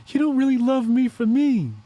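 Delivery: high whiny put-on girlfriend voice; mock Mystique. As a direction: plead like a hurt shapeshifter girlfriend. Voicing Mystique, high far voice.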